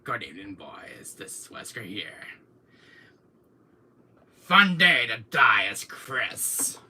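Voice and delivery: slow whispered threat at the end; whispered villain intro; Wesker impression. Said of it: "go like this".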